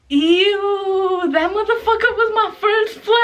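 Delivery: high squealing laugh through the words; disgusted giggling squeal. Real, not acted. Laughing disgust at fake flower.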